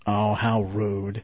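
slow low sassy how rude; Stephanie Tanner impression. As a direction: drawl it with sass. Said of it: "she always had that".

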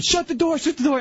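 high, mock screamed order; shouting at a friend outside the car, high and urgent; retold scream. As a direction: yell it like a frantic driver. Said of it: "So I started screaming".

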